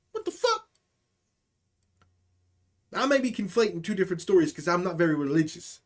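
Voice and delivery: ordinary talk, nothing marked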